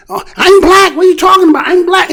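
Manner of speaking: indignant shout, imitating a politician caught out; mock indignant insistence. Mocking candidates claiming blackness.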